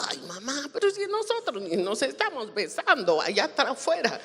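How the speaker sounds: high-pitched indignant daughter; exaggerated "but Mom" whine; whiny teen protest. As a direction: complain to mom like a wronged teenager. Teenager protesting to scolding mother.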